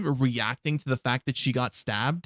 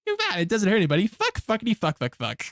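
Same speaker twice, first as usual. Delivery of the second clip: higher, lightly voiced cartoon kid chant; South Park kid voice; 6 semitones higher, faster than usual. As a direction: rattle off the swears like a cartoon brat. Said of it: "Fucking South Park".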